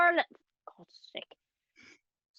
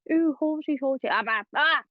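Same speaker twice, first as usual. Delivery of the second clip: higher-pitched mock flubbed accent babble; blooper gibberish; 4 semitones higher, 4 dB louder than usual. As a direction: babble nonsense syllables like a botched take. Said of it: "my wee blooper reels for my different accents".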